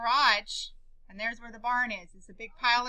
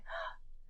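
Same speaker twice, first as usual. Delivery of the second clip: imitated breathy gasp; quick voiceless shocked breath; 10 dB quieter than usual. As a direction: gasp as dad did on seeing it. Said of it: "He gasped".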